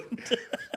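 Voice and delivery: short breathy laugh at a friend's jab; amused little laugh; real laugh. Real, not acted. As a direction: let a quick laugh slip out. Laughing at the teasing.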